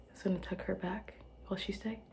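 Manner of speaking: soft unvoiced whisper; whispered aside. Real, not acted. Settling someone beside her, whispered. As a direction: hush so she stays asleep.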